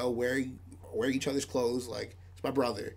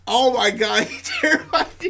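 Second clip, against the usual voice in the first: laughing; 10 semitones higher, 11 dB louder than usual. Real, not acted. Laughing while telling.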